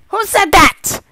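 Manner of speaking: loud, high angry demand; shouted demand. Acted. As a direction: yell it at the crowd. Officiant demanding who interrupted.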